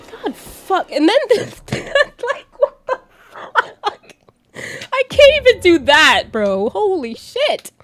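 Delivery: high, loud laughing through the words; can barely talk for laughing; cracking up at something happening in the game; laughing hard. Real, not acted. Something on screen cracks her up.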